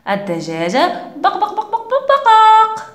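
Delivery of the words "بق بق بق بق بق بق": high, bouncy clucking "بق بق"; chicken clucking. Retold or imitated. Teacher imitates the hen for the children, high clucking. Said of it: "ما صوت الدجاجة؟".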